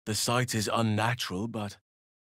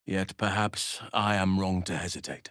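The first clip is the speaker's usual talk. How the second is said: usual speaking voice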